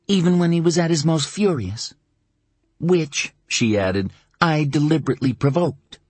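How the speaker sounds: mother's voice. Acted. Mother defending her boyfriend to her son, earnest. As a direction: defend him to your son, steady.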